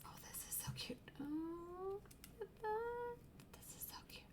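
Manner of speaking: hushed whisper over kittens; soft awed whisper. Real, not acted. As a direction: breathe it, barely audible.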